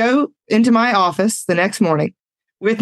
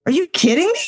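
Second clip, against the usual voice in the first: higher-pitched, mock-indignant panic; alarmed disbelief; 8 semitones higher than usual. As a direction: replay a panicked inner protest. Thinks she is having heart attack.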